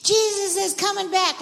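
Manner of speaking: high, loud rallying shout; loud yell. Shown as a demonstration. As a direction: lead the kids in a yell. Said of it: "Yell as loud as you can".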